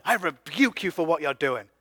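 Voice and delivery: hammed-up preacher condemning a sinner; harsh rebuke. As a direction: preach hellfire at them, rising to a yell. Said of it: "just 'cause I'm not going".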